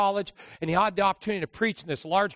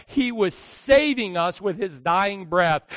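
ordinary talk

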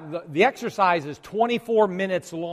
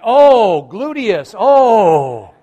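loud, higher groan of sore glutes; pained groan sitting down; 5 semitones higher, 12 dB louder, much slower than usual. Retold or imitated. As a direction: groan while lowering onto a seat. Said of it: "I went".

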